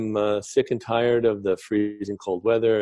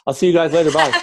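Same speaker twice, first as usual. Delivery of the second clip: quick, high, loud mock farewell with laughter; joking sign-off; 8 semitones higher, 6 dB louder, faster than usual. Guest pretends the podcast is already over, laughing. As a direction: rush out a laughing, jokey goodbye.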